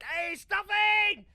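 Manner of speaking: slow, loud, high call. Retold or imitated. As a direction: shout his nickname across the room. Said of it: "It's like".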